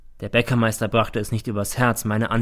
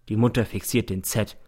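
ordinary delivery

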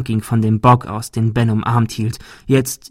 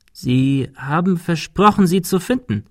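langsame, höhere Geisterstimme; 6 semitones higher than usual. A narrator's reading. Geist des Butlers mahnt.

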